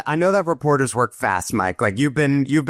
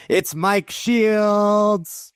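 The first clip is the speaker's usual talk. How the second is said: drawn-out, high announcer call; announcer reveal; 10 semitones higher, much slower than usual. Acted. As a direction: stretch the name out like a ring announcer. Host introduces guest grandly.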